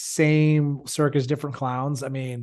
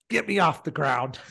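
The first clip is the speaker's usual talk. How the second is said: mock German-accented demand; Arnold Schwarzenegger. Drunk German man to the responder, mock Schwarzenegger-style German accent. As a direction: put on a thick Austrian-German action-hero accent. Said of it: "it was Arnold Schwarzenegger".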